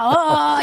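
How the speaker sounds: high-pitched mock wail; imitated daughter's scream. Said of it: "I hear her screaming out".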